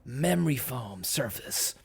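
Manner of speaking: slow breathy whisper on the feature. Acted. Host teases the foam lining, slow whisper. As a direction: hush it like a luxury secret.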